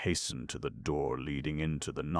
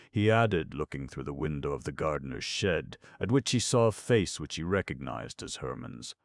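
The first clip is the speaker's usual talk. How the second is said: ordinary delivery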